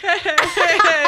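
slow, high, laughing mock catcall; flirty guys.

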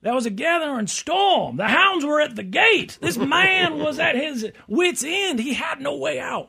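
high, slow, booming southern lawyer; passionate closing argument. Pretend. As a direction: preach it to the jury, all out. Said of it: "you gotta give that big southern".

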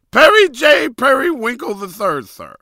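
very high, loud nerd voice, full blast; nerdy character voice. Retold or imitated. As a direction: squeal his own name like a nerd. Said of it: "he always talked like this".

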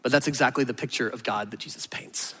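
hushed whisper, almost no voice. Real, not acted. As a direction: let it land in a whisper. Sermon turn to the point.

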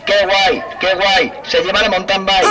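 helpless giggling through the lines; laughing fit. Real, not acted. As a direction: clerk can't stop laughing at the fair key. Clerk cracking up at megaphone.

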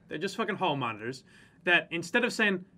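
normal talking voice

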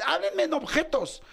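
exigencia aguda y cada vez más fuerte; exasperación retratada. Retold or imitated. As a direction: pide claridad desesperado, subiendo el volumen. Pide exasperado que le expliquen. (exasperado, alzando la voz)

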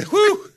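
high, loud exhaled whew; mock amazed whew. Therapist overwhelmed by a patient, mock amazed whew. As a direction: blow out a big exaggerated whew.

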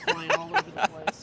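words broken by laughter; laughing. Real, not acted. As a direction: laugh through the line. Fly distracting hosts on air.

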